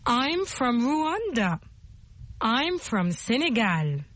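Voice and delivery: very slow language-lesson delivery; model to repeat. Shown as a demonstration. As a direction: space it out slowly for learners to copy. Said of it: "en répétant après moi".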